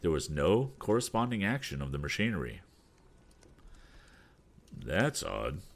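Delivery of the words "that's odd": curious voice. Acted. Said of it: "remarked in a curious voice".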